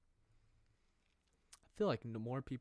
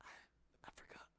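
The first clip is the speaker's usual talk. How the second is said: breathy embarrassed admission, slowly; sheepish whisper; 17 dB quieter, much slower than usual. Real, not acted. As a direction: sigh then whisper it, slow.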